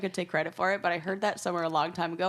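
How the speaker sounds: usual speaking voice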